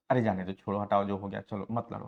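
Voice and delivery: soft, low calming plea; soothing. Retold or imitated. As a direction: gently talk them out of fighting. Soothing a fight, voiced. (धीमी, नरम समझाने वाली आवाज़)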